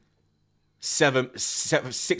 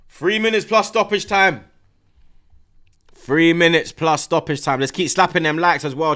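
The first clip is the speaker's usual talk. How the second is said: rally everyone for the finish; tense hype call; 6 semitones higher, 8 dB louder than usual. Real, not acted. Streamer at the tense finish, loud urgent call to chat.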